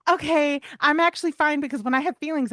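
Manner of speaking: high, loud little dog voice; voicing Miley. Owner voicing her young dog checking in, high loud dog voice. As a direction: pitch way up and speak as the dog.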